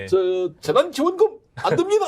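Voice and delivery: high, lisping, hesitant refusal; Hong Nam-ki impression. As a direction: refuse like a stiff minister, lisping. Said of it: "약간 좀 혀 짧은 소리 내고". (혀 짧은 소리로 높게 거절하듯)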